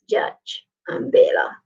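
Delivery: whispered "Judge". Shown as a demonstration. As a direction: breathe the word out.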